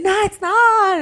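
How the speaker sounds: high, loud, mock-indignant no; high, loud mock-outraged denial; mock protest. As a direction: squeal the denial like a panicked mother in labor. Mother in labor protesting.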